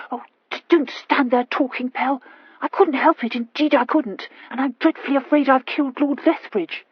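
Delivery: high, stammering and anxious; a frightened girl stumbling over words; distressed young lady. A narrator's reading.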